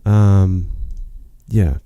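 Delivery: ordinary talk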